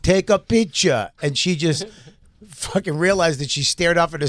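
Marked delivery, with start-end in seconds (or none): 0.0-1.1 put-on African accent. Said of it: "He said".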